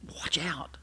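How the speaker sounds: hushed urgent warning; whisper. Real, not acted.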